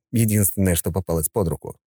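plain reading voice

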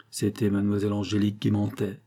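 usual speaking voice

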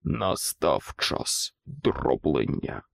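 low, slow, threatening rumble; a monstrous boot speaking slow and low; deep menacing voice. A narrator's reading. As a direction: loom over them with a bass growl. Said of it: "басовитий погрозливий голос".

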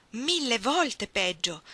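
drawn out, pressing hard; a thousand times worse, slowed right down; emphatic. Acted. Lover's anguished reply.

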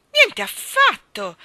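slow, high, loud furious denial. A narrator's reading. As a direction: explode in drawn-out royal fury. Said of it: "si infuriò il re". (furioso, da re)

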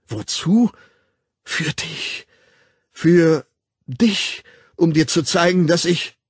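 high, agitated, broken phrases; loud, fervent, halting outburst; desperate pleading. A narrator's reading. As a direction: strain to confess, stopping short. Desperate lover struggles to confess.

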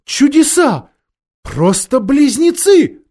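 high, loud wonder; queen's astonished outburst; exclaiming. A narrator's reading. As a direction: cry out in amazement, stretching the words. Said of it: "вскричала королева".

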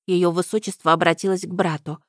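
ordinary delivery, nothing marked

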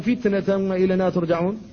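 فتنة with tense ta, then وإلينا ترجعون flowing on; firm ta. Shown as a demonstration. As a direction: keep the ta in فتنة tight. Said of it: "فيقال". (فتنة بتاء مشددة ثم وإلينا ترجعون)

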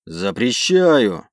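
slow loud forbidding bark. Acted. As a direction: bark the refusal. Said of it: "рявкнул командир".

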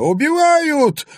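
put-on high, loud old crone scream; frantic yell. A narrator's reading. Old woman screams for help, high and loud. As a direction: shriek for help. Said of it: "истошно завопила старуха".

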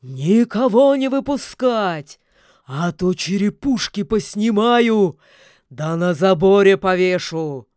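very loud bellowed command; furious, booming villain's order; shouted order. A narrator's reading. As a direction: bellow a threat to the guards. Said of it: "Уже снаружи донеслось".

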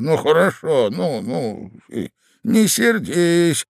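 high, drawn-out placating lilt; sing-song old man. A narrator's reading. Old husband placating his cross wife, sing-song. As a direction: sing it out soothingly. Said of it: "возразил старичок нараспев".

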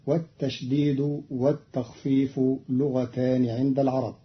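ordinary talk

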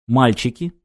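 usual speaking voice

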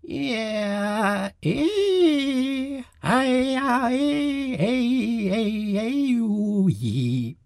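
piercing, very high shrieked vowels; screeching creature wailing vowels; shrill vowel cries. A narrator's reading. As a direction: cut through the tent with shrill wordless tones. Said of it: "gellte mit schneidenden Tönen bloß die Vokale".

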